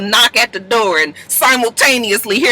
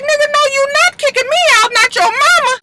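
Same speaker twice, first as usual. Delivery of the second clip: acted indignant retort; faster than usual. Voicing mother refusing to leave.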